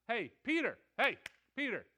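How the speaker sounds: loud, high call for attention. Retold or imitated. Jesus calls Peter out of the crowd, calling out.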